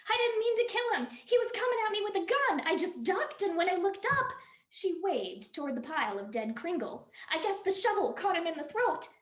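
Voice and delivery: high, loud, rushed panic; frantic woman confessing a killing; panicked woman. Acted. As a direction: blurt it out in a frightened rush.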